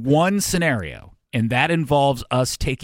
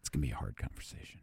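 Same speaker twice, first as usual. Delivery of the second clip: fast, hushed, much lower; worried whisper; 11 semitones lower, 18 dB quieter, faster than usual. Acted. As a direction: hurry it out in a grave whisper. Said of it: "Why are we whispering?".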